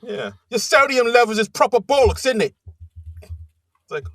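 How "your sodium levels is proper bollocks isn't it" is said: higher, louder hammed-up Cockney accent. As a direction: relish the Cockney accent, quoting the intern. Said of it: "I think you just like doing a Cockney accent".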